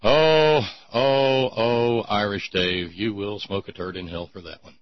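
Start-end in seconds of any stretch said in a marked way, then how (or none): none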